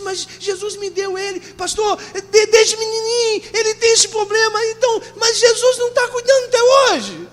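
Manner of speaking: high, building cry of a distressed mother; tearful protest. Retold or imitated.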